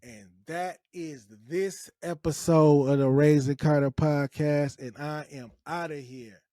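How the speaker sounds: loud host sign-off, announcer style; podcast sign-off. Real, not acted. Host closing the podcast episode, big announcer voice. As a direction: announce the show's close like a host.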